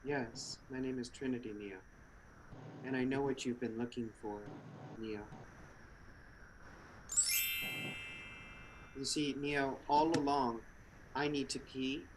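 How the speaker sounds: low hushed mysterious woman. Acted. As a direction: speak low and secretive, like a mysterious agent. Plays Trinity, low quiet voice.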